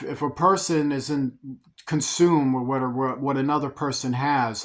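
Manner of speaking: normal talking voice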